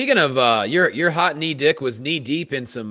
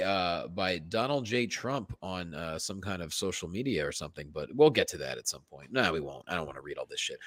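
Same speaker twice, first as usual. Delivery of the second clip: muttered aside; 10 semitones lower, 9 dB quieter than usual. Real, not acted.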